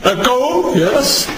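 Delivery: calling out into an echoing tunnel. Real, not acted. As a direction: shout a word to hear it echo. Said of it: "As you can hear me echoing here".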